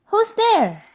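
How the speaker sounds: high startled mouse cry; frightened squeak. Acted. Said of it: "Mouse cries".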